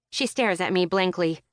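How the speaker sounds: plain reading voice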